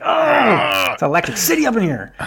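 camp it up like dizzy lovers caught in the moment; hammed-up swoon. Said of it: "And they're just like".

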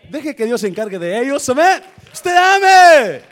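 loud, high preaching cry; preaching shout. Real, not acted. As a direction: proclaim it at full force. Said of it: "preaching climax".

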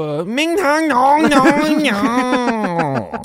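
put-on very high, slow, loud, sing-song Chinese accent; mock Chinese accent. Mocking restaurant name in a high Chinese accent. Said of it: "I don't know if you can say it like that".

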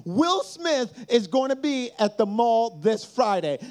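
usual speaking voice

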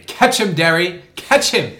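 high, loud frantic yell of Catch him; hammed-up panicked yelling; imitating frantic yelling. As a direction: shriek it like the panicked man in the clip. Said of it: "have you seen the YouTube video".

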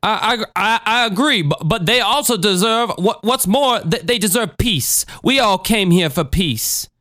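stammering high-pitched preacher; halting, placating sermon delivery; Jim Jones. Acted. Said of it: "a recorded exchange between Christine and Jim Jones".

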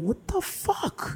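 high, soft, slow disbelief; hushed shock. Retold or imitated. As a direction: breathe it out in shock. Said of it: "You're like".

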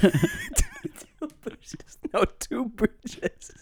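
high, slow, laughing caricature. Acted. Comedian repeats the joke in the caricature voice. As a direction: drag out two bridges in the hammy voice. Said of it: "problematic a Chinese voice".

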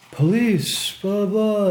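high mock police yell, trailing into gibberish; yelled police call, heard from afar; imitated muffled yelling. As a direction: mimic a cop yelling through the house. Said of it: "muffled yelling".

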